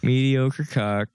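"Mediocre cock" drawn out slowly; slow deadpan repeat. Retold or imitated. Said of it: "the way you said mediocre cock".

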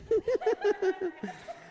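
high-pitched real laugh breaking out; high burst of laughter. Real, not acted. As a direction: laugh helplessly, high and bright. Laughs at tarantula.

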